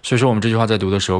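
ordinary talk, nothing marked

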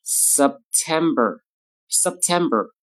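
September slow, p held before t; slow September with lost p; unreleased p. Shown as a demonstration. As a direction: hold the p in September. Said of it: "第二个".

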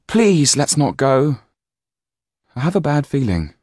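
put-on high, anxious boy begging; desperate plea. A narrator's reading. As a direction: plead with them to stay. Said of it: "Jan said desperately".

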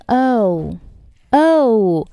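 "O" drawn out slowly, closing on an oo; vowel ending in u. Shown as a demonstration. Teacher shows the vowel's ending, slow o-to-u glide. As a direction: stretch "O" until it glides into u. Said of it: "它末尾是u".

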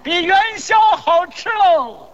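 slow, drawn-out street cry; hawker's shout. Pretend. Seller outdoing his own silly praise, drawn-out hawker's shout. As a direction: holler it long to the market.

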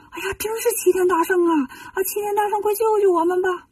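high, eager cry of relief; pleading at last, voice lifted high; excited recognition. Acted. Monks recognize the Great Sage.

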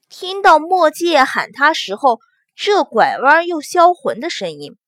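ordinary delivery

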